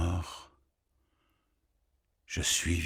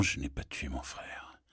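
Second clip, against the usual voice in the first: whispered denial; 3 semitones lower, 8 dB quieter than usual. Acted. Whispered denial after repetition.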